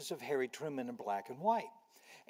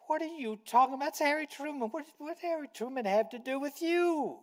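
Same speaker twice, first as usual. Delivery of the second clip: exasperated wife; 12 semitones higher than usual. Retold or imitated. Wife's quote, same scolding manner.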